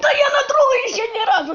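shrill chipmunk panic; squeaky voice. Real, not acted. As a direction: helium voice crying out.

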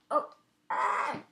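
high pained groan of my neck; hammed-up pained wail; mock pain. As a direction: play up the hurt neck. Game character hurt.

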